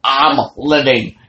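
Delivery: triumphant shout. Retold or imitated. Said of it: "And she's".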